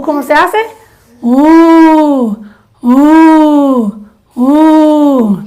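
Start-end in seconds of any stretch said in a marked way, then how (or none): 1.2-5.4 a loud, held u from the chest; chest u vowel. Shown as a demonstration. Coach leading the exercise, loud chest vowel. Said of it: "una U débil y de adentro".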